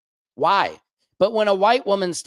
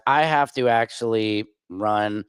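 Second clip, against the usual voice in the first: normal talking voice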